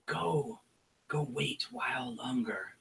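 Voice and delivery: a sly shaman sharing a secret grudge; goblin shaman whisper. Acted. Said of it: "And she says".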